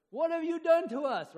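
loud, high accusing shout; angry cry to God. Retold or imitated. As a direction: hurl the question at God. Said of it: "we can cry out in different ways".

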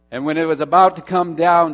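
building tension. Acted. Climax of Abraham story.

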